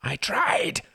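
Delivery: high, loud desperate cry; anguished cry. A narrator's reading. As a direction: burst out in anguish.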